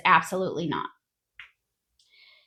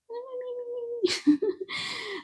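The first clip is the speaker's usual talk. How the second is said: a high, breathless burst of laughter; 8 dB quieter than usual. Real, not acted. Nurse cracking up at her own bragging, high laugh.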